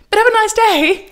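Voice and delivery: high, loud, chirpy farewell; airy fake-cheerful art director voice; fake-sweet director. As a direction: cover the awkwardness with cheer. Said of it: "She goes".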